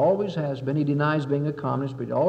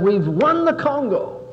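gloating Moscow; 5 semitones higher, 3 dB louder, slower than usual. Retold or imitated. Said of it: "Moscow ... and said".

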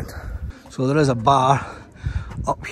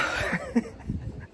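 breathless laugh; 10 semitones higher, 8 dB quieter than usual. Real, not acted.

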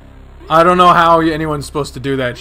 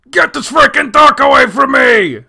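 high, loud frantic shout; yelled outburst; 10 semitones higher, 7 dB louder than usual. Acted. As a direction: yell it out in a panic. Yelled quote about old game.

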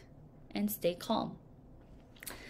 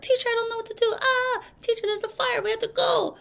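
high, frantic student voice; panicky student; 12 semitones higher, faster than usual. Retold or imitated. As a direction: squeal like a panicked kid. Panicking students in fire drill.

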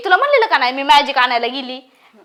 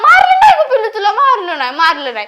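put-on high, loud cooing to a child; much higher, placating the little one; hasty reassurance; 4 semitones higher, 6 dB louder, faster than usual. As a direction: protest your innocence in a high baby-talk pitch. Hastily soothing a child who protests.

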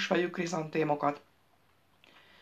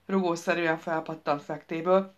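ordinary delivery, nothing marked

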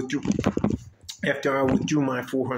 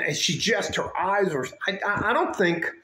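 ordinary talk, nothing marked

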